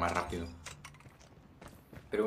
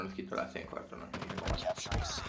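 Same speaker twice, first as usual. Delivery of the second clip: normal talking voice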